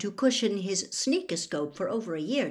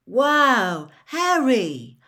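loud, drawn-out wow of delight; thrilled; 5 semitones higher, 7 dB louder, much slower than usual. A narrator's reading. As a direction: exclaim in delight at a gift. Delighted at opening a present.

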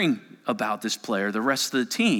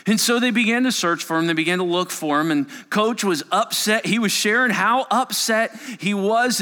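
urgent search; 6 semitones higher, 4 dB louder than usual. A narrator's reading.

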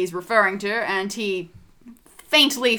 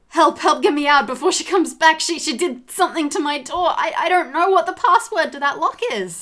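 higher, agitated stammering plea; panicked babbling; 6 semitones higher, 4 dB louder than usual. A narrator's reading. Trapped doctor begging to get out before she returns, panicked babbling. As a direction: babble for help, tripping over your words. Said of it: "sort of babbling a little bit".

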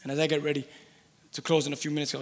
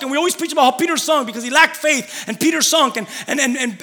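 normal talking voice

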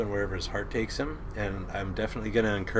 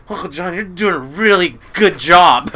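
put-on high, loud, slow kid quote; voiced little son; 8 semitones higher, 14 dB louder than usual. Soaked son addressing Uncle John, imitated slow and loud. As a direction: imitate your kid laying on slow praise. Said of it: "He looks straight at Uncle John and says".

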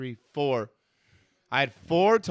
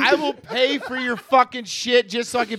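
mock threat shout; 7 semitones higher, 7 dB louder than usual. Mock threat in friendly banter.

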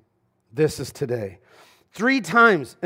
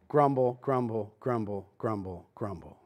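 each grumble lower and softer until it vanishes; fading grumble; 7 semitones lower, 10 dB quieter than usual. Pretend. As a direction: let the grumbles die away to a murmur. Mock grumbling, repeated.

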